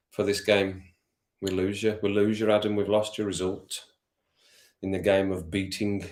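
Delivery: ordinary delivery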